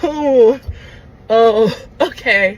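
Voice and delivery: drawn-out stunned realization; a slow, disbelieving oh okay. Real, not acted. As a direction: let it sink in slowly, dumbfounded. Stunned reaction to the date.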